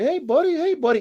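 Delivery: high sweet voice for a dog; baby talk to dog. Retold or imitated.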